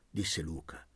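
plain reading voice

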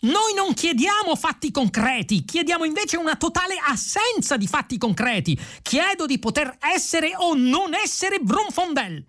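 breathless very high-pitched tirade; excitable indignant protester; yelled rant. A narrator's reading. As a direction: rattle off the demands as a strained high cry. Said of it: "Vrumfondel gridò".

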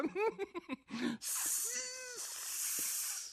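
very high, soft muffled giggle; stifled giggle. A narrator's reading. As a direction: smother a burst of laughter. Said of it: "sbottò in una risatina soffocata".

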